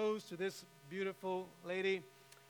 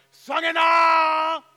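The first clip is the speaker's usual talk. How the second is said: long, high, drawn-out yell of a name; screaming for daughter; 10 semitones higher, 19 dB louder, much slower than usual. Retold or imitated. Father-in-law calling his daughter down from upstairs, full scream. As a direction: call her downstairs at the top of your lungs. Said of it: "Screaming at the top of his lungs".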